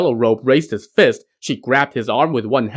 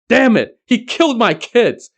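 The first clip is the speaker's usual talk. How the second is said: much higher, louder furious outburst; hopping mad; 11 semitones higher, 4 dB louder than usual. A narrator's reading. Yellow Robe raging about his stolen children. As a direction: explode with rage over the kids. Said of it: "Yellow Robe was hopping mad".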